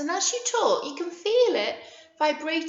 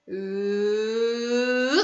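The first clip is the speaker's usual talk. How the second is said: long "ooh" gliding upward; voice-throwing sound. Shown as a demonstration. Teacher throwing her voice to nursery children, rising ooh. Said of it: "threw my voice at you".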